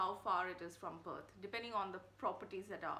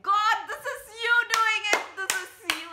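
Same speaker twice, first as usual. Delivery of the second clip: much higher, loud, astonished cry to God; awed thanks to God; 12 semitones higher, 16 dB louder than usual. Retold or imitated. Realising God arranged the sale, loud and awestruck. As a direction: cry out in amazed gratitude. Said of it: "And I was like".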